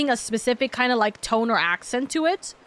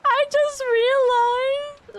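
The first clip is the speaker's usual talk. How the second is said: mock crying; 13 semitones higher, much slower than usual. Continues the mock cry.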